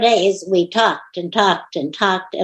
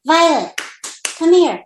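raised, higher call to a dog across the room; 7 semitones higher than usual. Real, not acted. Owner calling her dog Violet to come, raised call.

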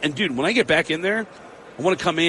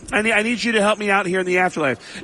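Sam Kinison impression; 6 semitones higher than usual. Prank caller as Kinison asking Tom Arnold for help, light put-on. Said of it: "And I said".

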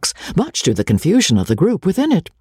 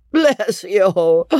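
tipsy high hiccuping voice; hiccuping drunk; 6 semitones higher than usual. A narrator's reading.

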